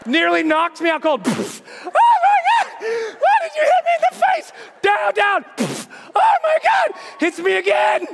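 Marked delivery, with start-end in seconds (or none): 1.3-4.5 very high, fast panicked screech; hammed-up hysterical scream; shrieked outrage. Punched by own hand, screaming. 4.8-5.5 higher-pitched shouted order; acted panicked shout; frantic command. Shouting at own flailing hand. 6.1-6.9 loud, very high screech; hammed-up hysterical shriek; shrieked shock. Hit in the face again.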